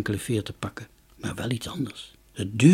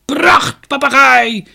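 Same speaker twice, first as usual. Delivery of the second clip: much higher, loud hawker's cry; market shout; 13 semitones higher, 12 dB louder, slower than usual. A narrator's reading. Merchant advertises his parrot to the crowd, shouting. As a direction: bellow it across the market square. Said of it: "schreeuwde de koopman".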